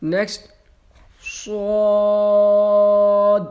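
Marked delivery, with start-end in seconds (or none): 1.5-3.4 صاد stretched, mouth open, louder; full-mouthed long صاد; heavy long Suad. Shown as a demonstration. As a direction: open wide and drag out صاد. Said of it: "کھینچ کے اور لمبا کر کے پڑھتے ہیں".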